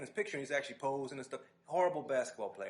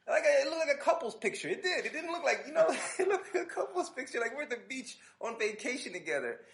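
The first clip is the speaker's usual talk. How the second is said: high laughing disbelief, much louder; 11 semitones higher, 8 dB louder than usual. Real, not acted. Telling a friend about the groupie photo, laughing. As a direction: laugh through the embarrassment. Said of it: "laughing at his groupie photo".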